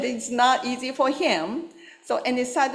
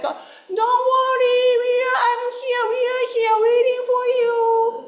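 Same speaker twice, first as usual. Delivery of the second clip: very high, louder singsong call; geese crowd calling; 6 dB louder than usual. Retold or imitated. Flock sings back to the little goose, very high and louder. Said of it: "crowd saying like".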